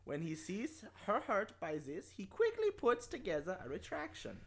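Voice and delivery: mock German accent. Host reading the plot in a put-on German accent. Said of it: "the German accent".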